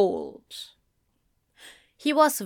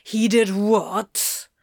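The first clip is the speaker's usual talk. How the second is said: slow, menacing disbelief; snarling; much slower than usual. A narrator's reading. Crowley hearing that Gabriel threatened his angel, snarling. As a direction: snarl it word by word. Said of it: "Crowley snarled".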